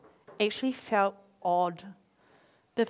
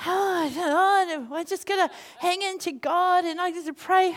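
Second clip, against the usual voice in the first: mock pious sigh; 6 semitones higher, 4 dB louder than usual. Mocking the pious couple, whiny lament.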